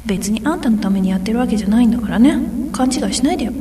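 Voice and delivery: put-on fast, huffy tsundere girl voice. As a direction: act annoyed but secretly care. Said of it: "例のツンデレですよ。".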